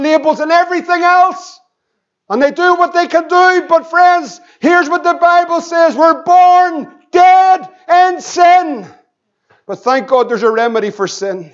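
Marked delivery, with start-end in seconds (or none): 0.0-8.9 raised, ringing pulpit proclamation; forceful preaching. Acted.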